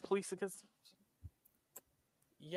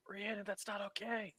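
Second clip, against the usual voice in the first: urgent hissed whisper; whisper yell; 8 dB quieter than usual. Acted. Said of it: "whisper yell".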